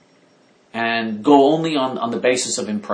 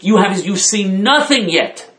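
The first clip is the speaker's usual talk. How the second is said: ordinary delivery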